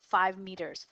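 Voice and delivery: usual speaking voice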